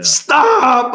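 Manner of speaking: high, loud drawn-out stop; shout. Retold or imitated. Guard yells at his armed team to stop, high and loud. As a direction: yell to halt them.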